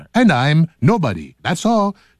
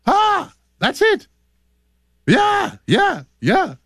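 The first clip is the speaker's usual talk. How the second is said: excited squeal; slower than usual. Retold or imitated. Imitating rap exclamations.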